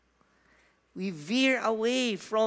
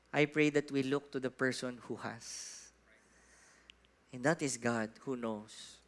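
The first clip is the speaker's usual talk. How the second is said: prayer; 8 semitones lower, 4 dB quieter than usual. Acted. Pastor prays over the congregation.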